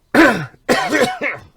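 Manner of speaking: cough. Real, not acted. Coughing mid-talk.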